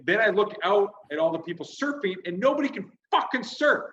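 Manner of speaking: high, incredulous comic outrage; exasperated rant. Retold or imitated. Comedian sees nobody can surf, high exasperated rant. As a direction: build to a high-pitched peak, then ease down.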